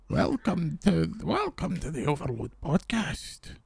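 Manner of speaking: put-on slow, soft, creaky old-timer; old man voice. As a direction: lean into sounding ancient and wise, slowly. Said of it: "Do I sound older? Wiser?".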